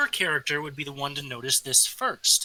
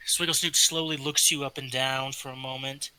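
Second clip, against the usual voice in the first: usual speaking voice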